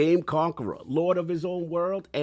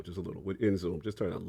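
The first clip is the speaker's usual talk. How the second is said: softer; 7 semitones lower, 9 dB quieter, faster than usual. Shown as a demonstration. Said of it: "you're booming just a little".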